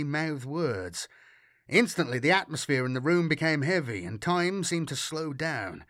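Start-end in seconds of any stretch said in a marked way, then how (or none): none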